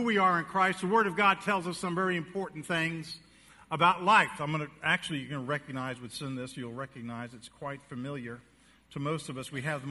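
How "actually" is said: usual speaking voice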